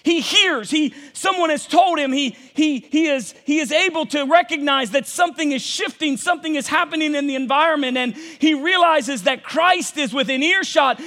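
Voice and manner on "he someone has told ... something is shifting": ordinary talk, nothing marked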